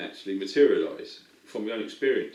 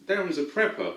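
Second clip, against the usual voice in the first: higher-pitched retold wife; imitating wife; 8 semitones higher than usual. Wife telling the table about her husband, lightly imitated. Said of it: "And my wife said".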